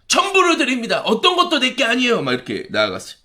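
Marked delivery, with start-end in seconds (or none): 0.1-2.2 high-pitched acted vow of surrender; fervent devotion. Spirit-filled believer offering everything to God, fervent high declaration. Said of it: "성령 충만해서".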